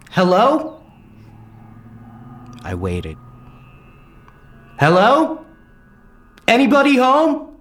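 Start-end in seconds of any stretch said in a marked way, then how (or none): 0.1-0.8 high questioning call into the house. Acted. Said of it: "called out". 4.8-7.5 higher, louder call into the house. Acted. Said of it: "called out".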